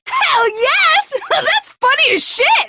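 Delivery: laughing villain crowing; gleeful shriek. Acted. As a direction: cheer it out with a laugh. Persona shrieks with delight.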